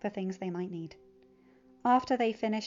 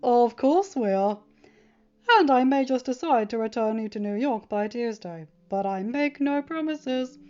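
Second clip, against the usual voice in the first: winking Tony; 3 semitones higher, 7 dB louder than usual. A narrator's reading. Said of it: "Tony said with a wink".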